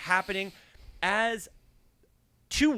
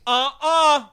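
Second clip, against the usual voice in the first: loud, higher insistent denial; defensive driver; 8 semitones higher, 10 dB louder than usual. Retold or imitated. Driver protesting his innocence, a loud, higher voice. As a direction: insist it wasn't you, loudly. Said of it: "He goes".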